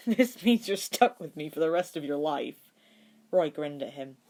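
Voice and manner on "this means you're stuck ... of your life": laughing. Acted. Laughing through tears.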